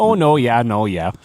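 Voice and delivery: hammed-up Upper Midwest accent; Wisconsin accent. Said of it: "stereotypical Wisconsin accent on it".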